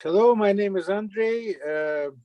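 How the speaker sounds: usual speaking voice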